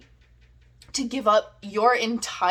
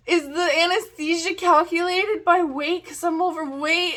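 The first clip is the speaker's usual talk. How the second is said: cringy drugged self; 7 semitones higher, 3 dB louder than usual. Retold or imitated. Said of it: "really cringy stuff to the O R nurses".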